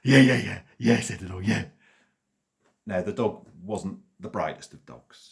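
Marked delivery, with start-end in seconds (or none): 0.0-1.6 loud, high, yappy eager dog; excited dog. A narrator's reading. Dog thrilled about going for a walk, loud and high. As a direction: bark out the yeahs like a thrilled dog.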